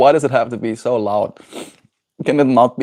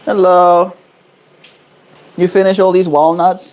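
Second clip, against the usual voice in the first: high bright pet talk; talking to a pet bird; 5 semitones higher, 5 dB louder than usual. Real, not acted. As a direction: chirp back at the bird. Greeting bird back.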